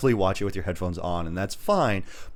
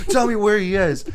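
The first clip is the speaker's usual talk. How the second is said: higher and louder, a hammed-up threat; mock tough-guy shout; menacing demand; 12 semitones higher, 6 dB louder than usual. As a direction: bark the demand at the grandma. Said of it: "He goes like".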